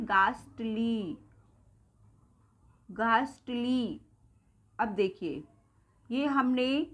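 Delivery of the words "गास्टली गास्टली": गास्टली very slowly with a long "aah"; drawn-out गास्टली, back vowel and silent H; second pronunciation. Shown as a demonstration. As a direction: stretch गास्टली into "gaaast-leee". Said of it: "second इसको क्या बोलते हैं".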